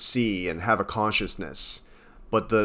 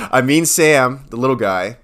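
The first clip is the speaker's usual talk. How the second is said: loud and higher, just out of a laugh; laughing self-correction; 5 semitones higher, 9 dB louder than usual. Real, not acted. Podcaster fixing the character's name for co-host, laughing. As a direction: correct yourself loudly, still laughing.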